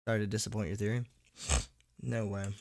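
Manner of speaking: normal talking voice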